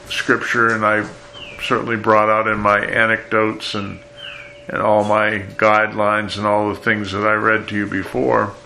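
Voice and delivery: ordinary delivery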